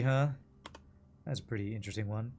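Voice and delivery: ordinary delivery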